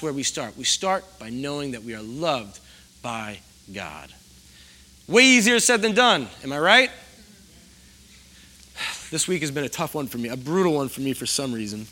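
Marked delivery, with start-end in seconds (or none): 5.1-6.9 raised, higher, louder quip to the room; louder aside. Real, not acted. Pastor teasing the congregation, louder aside. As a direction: call it out with a knowing lift.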